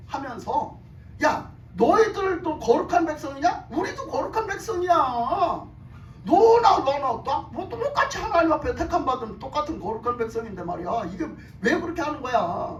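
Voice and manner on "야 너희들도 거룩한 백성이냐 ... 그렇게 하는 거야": confrontational arguing. Retold or imitated. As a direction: act out rebels protesting they are equals. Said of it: "하면서 막 따지는 거야". (억울한 듯 따지는 흉내)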